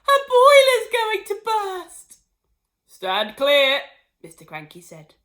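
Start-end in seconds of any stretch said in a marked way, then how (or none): 0.0-2.2 high loud panicked wail. Acted. Missus Cranky wailing at her swelling mother. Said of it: "Missus Cranky wailed". 2.9-3.8 warning. Acted. Husband warns of bursting grandma.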